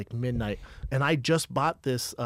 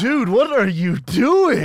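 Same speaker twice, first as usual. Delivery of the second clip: loud, high, drawn-out retold disbelief; mock incredulous outcry; 7 semitones higher, 7 dB louder, slower than usual. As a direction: stretch it out, appalled at a buddy. Retells shock at friend's spitting.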